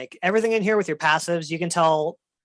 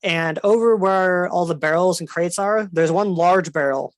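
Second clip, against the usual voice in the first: ordinary talk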